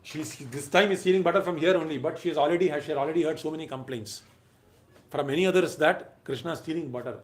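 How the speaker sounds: usual speaking voice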